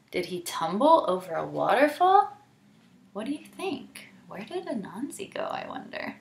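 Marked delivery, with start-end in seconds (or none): 3.1-6.2 quiet wonder. A narrator's reading.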